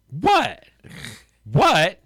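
high, falling, imitated clueless "what". Confronted rude customer who doesn't see it, clueless what. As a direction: play oblivious when confronted. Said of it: "'cause they don't even see it".

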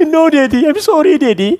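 put-on high, loud child's plea; pleading little girl. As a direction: beg like a small daughter caught out. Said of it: "Oi, bertobat sungguh-sungguh ni".

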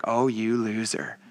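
slow, low put-down; mock scorn. Preacher voicing judgment of Judas, slow low mock scorn. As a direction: drop the pitch and drag out the insult. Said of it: "look at Judas and be like".

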